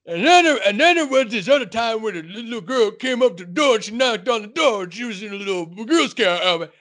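high, far-off retelling voice; old man storyteller. Retold or imitated. Chef's dad tells his own tale, voice pitched high. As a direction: spin the tall tale. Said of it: "my turn".

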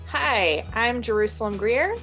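ordinary talk, nothing marked

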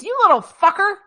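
high, loud mock parental outburst; hammed-up angry yell. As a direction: let the parent's real curse burst out. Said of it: "really what they mean is".

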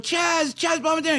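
high-pitched excited fan calls; fans calling out. Retold or imitated. Parade crowd spotting the star, retold high calling. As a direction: imitate a crowd hailing a celebrity. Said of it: "They're going".

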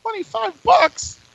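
high, slow disbelieving echo; incredulous. Real, not acted. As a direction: stretch it out in disbelief. Disbelief at ticket price.

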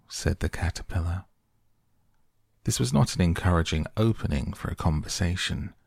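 ordinary delivery, nothing marked

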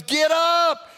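high, loud nagging holler; yelling wife. Acted. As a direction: holler like a wife rousing her husband. Acted quarrel, wife orders him up.

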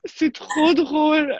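high, slow, drawn out through laughter; helpless amusement. Real, not acted. Guest amused by remark on her accent, laughing. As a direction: stretch it out while cracking up.

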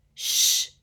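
loud, breath-only shush; frantic hushing; desperate shush. A narrator's reading. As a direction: shush urgently before anyone hears. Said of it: "said Hermione desperately".

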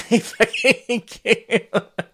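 long high laugh; real high-pitched laughter. Real, not acted. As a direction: crack up at your own joke. Laughs at own risqué joke.

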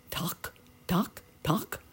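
hushed, clipped tocks; egg tapping. A narrator's reading. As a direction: imitate tiny knocks from inside an egg. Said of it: "went one of the eggs".

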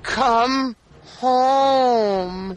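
high, drawn-out plaintive child imitation; mock pleading little kid, stretching the words; poor little girl. Co-host imitates the daughter on the news, high mock-pitiful plea. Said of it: "The poor little girl".

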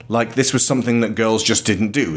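normal talking voice

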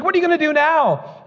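high-pitched frantic question; panicky wife. Retold or imitated. Wife asks husband about the caught mouse, mock panic.

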